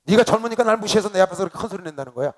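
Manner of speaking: loud acted motherly scolding; angry mother. Mother accusing son of disrespect, acted loud scolding. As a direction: burst out as an offended mother, loudly. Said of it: "딱 째려보시면서 뭐라고 알아요?".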